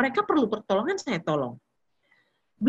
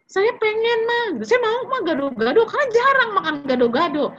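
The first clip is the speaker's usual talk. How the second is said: put-on childish pleading; 11 semitones higher, 4 dB louder than usual. Child begging mother for gado-gado.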